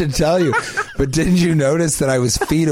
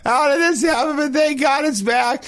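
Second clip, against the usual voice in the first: joyful relief. Retold or imitated. Man whose dick reattached, shrieking with joyful relief. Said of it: "screaming would turn to elation and joy".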